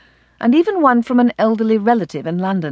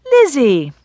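louder, bright happy call of a name; delighted exclamation; 5 semitones higher, 5 dB louder than usual. A narrator's reading. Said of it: "Anne exclaimed with delight".

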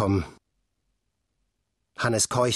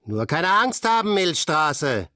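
high, teasing call to the climber; taunting shout upward; 6 semitones higher, slower than usual. A narrator's reading. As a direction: goad him from below, half mocking. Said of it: "rief Frank".

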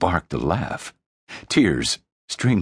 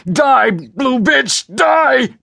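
much higher, very slow, loud frenzied scream; crazed shout; 7 dB louder, much slower than usual. Acted. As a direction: scream the kill, unhinged. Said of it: "laughing and crying".

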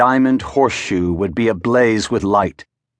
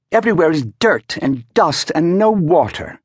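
complaining valet; 8 semitones higher than usual. A narrator's reading. Valet voiced again.

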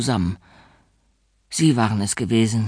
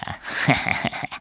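breathy snicker; 3 dB quieter than usual. A narrator's reading. Bully laughs after threat.

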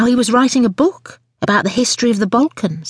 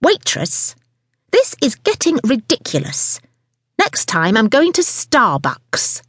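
understated angry yell; 4 semitones higher, slower than usual. A narrator's reading. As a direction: call out crossly without real volume. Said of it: "Missus Angry yelled".

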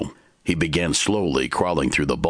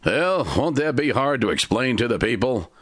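Timber asking; 9 semitones higher than usual. A narrator's reading. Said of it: "Timber asked".

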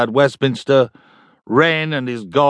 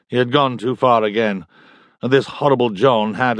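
plain reading voice

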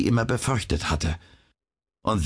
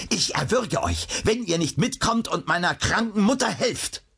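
hot-blooded outburst at raised pitch; enraged cry; 12 semitones higher than usual. A narrator's reading. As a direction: threaten in a high, urgent voice. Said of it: "schrie er".

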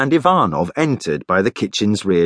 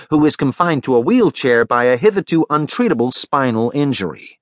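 raised-pitch ceremonial reporting; live TV commentary; 7 semitones higher than usual. Acted. Li Shan reports the ambassador's arrival, live commentary. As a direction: present the arrival to viewers, pitched up.

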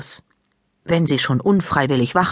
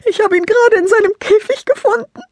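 put-on high, loud tearful child's voice; sniffling; 5 dB louder than usual. A narrator's reading. Lila tells Maike where she found her dead rabbit, sniffling. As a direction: sniffle through the words like a crying girl. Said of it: "schnieft Lila".

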